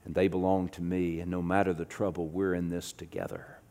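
low, very soft sermon voice; hushed preaching. Real, not acted. Preacher assuring the congregation they are not alone, hushed. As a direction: preach gently, almost under the breath.

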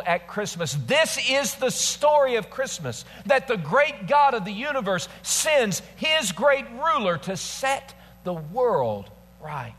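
high, ringing proclamation with a slowed close; impassioned preaching. Real, not acted. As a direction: proclaim it to the whole congregation. Preaches the main point.

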